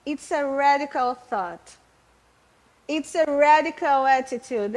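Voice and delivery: slow, careful English reading of It's a radical thought; English quote. Retold or imitated. Preacher quoting Sproul to the church in slow English. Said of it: "Ele diz assim, olha".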